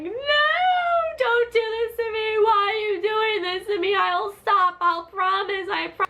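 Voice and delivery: high, drawn-out mock wail; hysterical crying. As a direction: beg in a hammed-up hysterical cry. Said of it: "like, crying hysterical".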